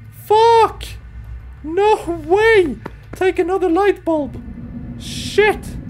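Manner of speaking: high frantic swearing; panicked yelling. Real, not acted. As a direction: yell in panic while fleeing. Panicked while chased by monster.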